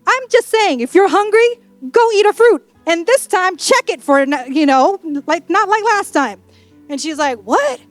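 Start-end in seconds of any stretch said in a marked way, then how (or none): 0.1-6.4 higher-pitched, defensive jab at a spouse; needling comeback. Acted. Adam sniping at Eve over the fruit, needling. 7.4-7.8 high, rising mock-offended question; indignant disbelief. Eve reacting to her husband's dig, indignant.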